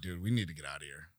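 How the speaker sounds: low, soft, quick aside; discreet urging. Retold or imitated. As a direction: quietly urge a buddy to go. Retold urgent aside to buddy.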